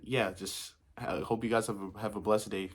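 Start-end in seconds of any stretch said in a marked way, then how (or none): none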